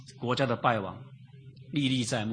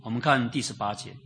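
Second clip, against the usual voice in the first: ordinary talk, nothing marked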